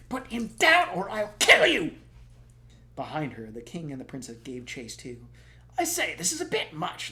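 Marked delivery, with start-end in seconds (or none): 0.1-2.0 high, loud threatening scream; furious shout. Acted. Sophie screams at the prince holding Morgan, furious. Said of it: "Sophie behind Waif shouting". 5.8-7.1 high, louder put-upon royal call; king calling out. Acted. The king protests while chasing the prince, flustered. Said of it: "the king called out".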